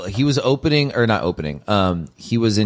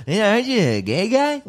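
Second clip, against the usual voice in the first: high mocking voice, much higher; mock Norm tease; 13 semitones higher than usual. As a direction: tease him in a high jeering voice. Norm teasing Kattan, retold.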